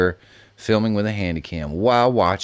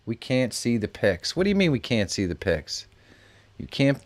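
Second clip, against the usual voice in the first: ordinary talk, nothing marked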